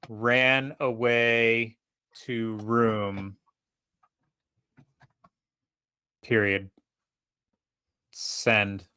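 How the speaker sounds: very slow, word-by-word dictation; dictating a text. Pretend. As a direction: spell it out slowly as if typing. Dictating a text message, punctuation included.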